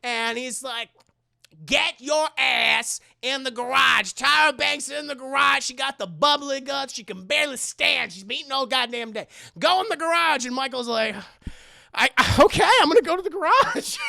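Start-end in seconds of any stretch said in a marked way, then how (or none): 1.6-10.4 loud, raised bossy rant; hammed-up scolding, hitting each phrase hard; mock yelling. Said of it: "if they just yelled at him". 11.9-14.0 loud, high, hurried mock compliance; hammed-up intimidated reply; flustered. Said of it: "gets flustered".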